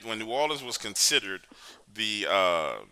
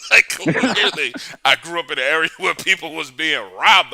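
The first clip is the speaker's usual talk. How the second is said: laughing; 5 semitones higher, 6 dB louder than usual. Real, not acted. Laughs at his own punchline.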